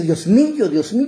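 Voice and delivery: loud, high-pitched plea to God; preacher acting an anguished shout; cry of anguish. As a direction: cry out as if hunted. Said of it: "él levanta un grito de angustia".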